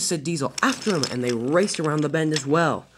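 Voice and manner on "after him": raised, higher command; chase cry. A narrator's reading. Diesel sending his boss after Thomas, urgent call. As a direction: urge the pursuit on.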